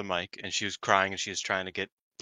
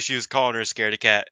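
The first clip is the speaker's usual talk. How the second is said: ordinary talk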